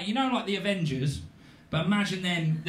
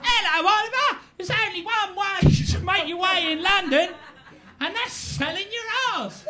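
put-on high, cheeky street-boy voice; artful dogger; 11 semitones higher than usual. Street boy greeting the newcomer to London, cheeky and high. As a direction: pipe it up high like a cocky young hustler. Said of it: "he meets the artful dogger. And he goes".

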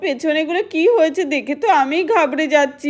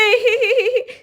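gloating laugh; 6 semitones higher than usual. Acted. Girl laughs back at Guddu.

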